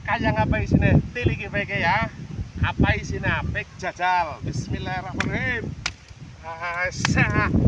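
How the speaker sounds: ordinary delivery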